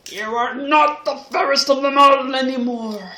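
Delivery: loud, put-on magic mirror voice. Magic mirror answers queen.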